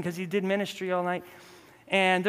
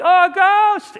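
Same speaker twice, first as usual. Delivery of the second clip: high, loud frightened cry; mock fright; 13 semitones higher, 12 dB louder, slower than usual. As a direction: shriek as if seeing a ghost, hammed up. Disciples see figure walking on water.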